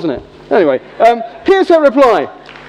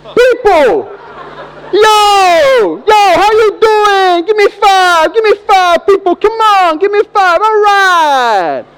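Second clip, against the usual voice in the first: much higher, loud hammed-up greeting shouts; cringeworthy extrovert; 11 semitones higher, 5 dB louder, slower than usual. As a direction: yell at strangers like an overeager hype man. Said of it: "you say".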